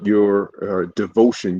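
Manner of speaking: usual speaking voice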